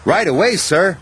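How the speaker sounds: high, eager reply. A narrator's reading. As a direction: answer eagerly, ready to go. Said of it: "whistled Thomas".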